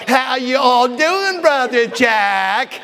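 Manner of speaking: slow, high, overdone put-on Southern greeting; fake Southern accent. Preacher mocking a California transplant greeting him in fake Southern. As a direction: lay the borrowed drawl on thick. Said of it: "They've been here three months".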